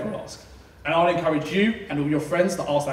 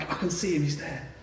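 hushed parent; 2 semitones higher, 10 dB quieter than usual. Retold or imitated. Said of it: "you're like".

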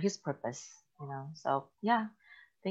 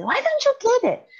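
high, loud frustrated yell; scream and yell; 9 dB louder than usual. Retold or imitated. Mom yelling at her homeschooled boys in frustration. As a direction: yell it at kids who won't understand. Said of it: "I would scream and yell".

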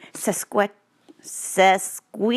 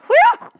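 whew; 11 dB louder than usual. Real, not acted. Reacting to skunk smell.

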